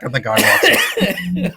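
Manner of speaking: usual speaking voice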